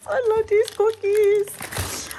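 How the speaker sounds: slow, high, savouring gush; swooning slow high delight; drawn-out delight. Real, not acted. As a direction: stretch every word with joy. Repeats, drawn out.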